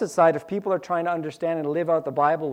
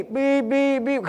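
high, loud imitated alarm beeping; alarm beeps; 8 semitones higher, 3 dB louder, slower than usual. Preacher mimicking his snoozed alarm, high beeping. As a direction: beep like a phone alarm.

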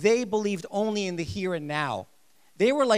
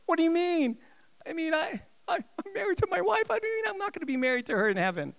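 alarmed; 11 semitones higher than usual. Retold or imitated. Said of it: "they read that and went".

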